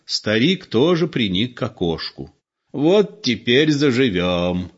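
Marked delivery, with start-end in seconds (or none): 2.7-4.7 put-on vexed old man. A narrator's reading. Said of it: "в сердцах сказал он".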